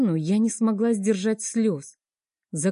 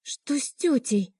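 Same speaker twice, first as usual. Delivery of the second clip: put-on girl's whisper; 5 semitones higher than usual. A narrator's reading. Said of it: "прошептала девочка".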